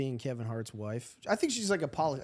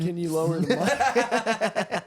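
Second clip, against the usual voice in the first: laughing; 2 semitones higher, 3 dB louder, much slower than usual. Acted. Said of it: "Coulda just said".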